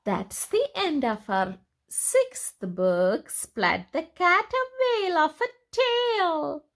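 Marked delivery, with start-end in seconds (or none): none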